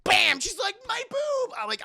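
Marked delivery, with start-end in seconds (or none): 0.0-0.4 loud, high exclaimed impact; punch sound effect. Retold or imitated. Imitates the punch landing. 0.9-1.5 very high mock squeal; pained squeal. Punched villainess cries out.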